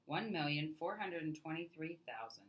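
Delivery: plain reading voice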